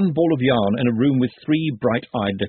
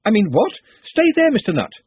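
higher, louder, sharply commanding; sharp raised voice; 10 semitones higher, 5 dB louder than usual. A narrator's reading. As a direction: Ponder snaps at Nutt to stay put, raised voice. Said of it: "so why shout at him like that?".

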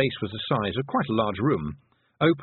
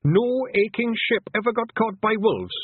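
gabbling; 12 semitones higher, 4 dB louder than usual. Acted. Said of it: "Rob Anybody gabbled".

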